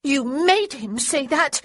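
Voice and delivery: put-on a girl red with rage at her brother; enraged girl. A narrator's reading. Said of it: "face grew red with rage".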